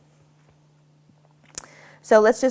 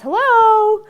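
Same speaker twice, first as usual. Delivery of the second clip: very high, loud doorbell hello; loud distraction hello; 12 dB louder than usual. Retold or imitated. Trainer testing the dog with a loud greeting, high and loud. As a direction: sing it out like greeting a guest at the door. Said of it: "everybody says".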